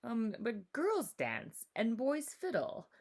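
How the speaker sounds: put-on slow, teasing boy's voice; laughing dismissively. A narrator's reading. Monuk teasing Matisse that girls don't fiddle, slow and mocking. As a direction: brush her off with a chuckle.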